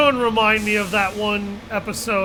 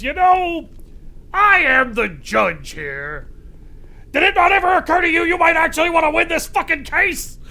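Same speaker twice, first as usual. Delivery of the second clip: higher, louder exasperated rant, slowing down on I am the judge here; mock exasperated judge; 8 semitones higher, 4 dB louder than usual. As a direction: play an indignant judge cutting someone off. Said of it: "Lewis interrupts him and he goes".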